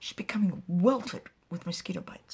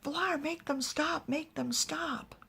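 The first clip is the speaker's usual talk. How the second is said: desperate pleading; 9 semitones higher than usual. Acted. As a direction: plead with rising pitch, not shouting. Attacked woman begs for help.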